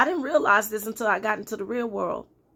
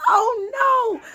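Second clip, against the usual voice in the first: high, loud wail of mock anguish; upset protest; 13 semitones higher, 3 dB louder than usual. Woman retelling waking from her dream, upset wail. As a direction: cry out high and loud in dismay. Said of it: "I was so mad".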